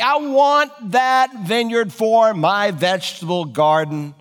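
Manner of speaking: put-on sulky baby whine, dragged out; whiny big baby. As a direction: pout it out like a sulking child. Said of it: "He's acting like a big baby".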